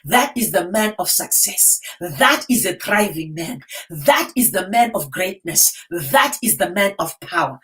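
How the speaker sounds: ordinary delivery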